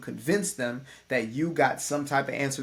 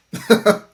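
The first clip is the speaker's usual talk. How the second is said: short burst of real laughter, loud; a quick embarrassed laugh out loud; real laugh at his own silly accent; laughing at himself; 7 semitones higher, 12 dB louder than usual. Real, not acted. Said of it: "laughs at own accent".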